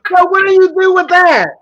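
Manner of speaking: high, loud disbelieving laugh-shout. Real, not acted. Asking the co-host what to do with that insult, laughing loudly. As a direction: cry it out while still cracking up.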